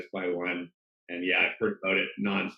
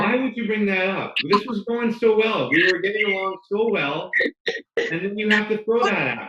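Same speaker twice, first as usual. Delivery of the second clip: much higher, louder playful protest; hammed-up indignation; mock outrage; 11 semitones higher, 5 dB louder than usual. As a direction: pretend to be wounded by the question. Mock outrage at golf question.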